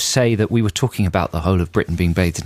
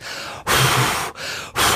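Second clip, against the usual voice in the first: two long puffs of breath; blowing noise; 4 dB quieter than usual. Shown as a demonstration. Host showing listeners how to blow the clouds away, blowing. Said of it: "faces into the sky and goes".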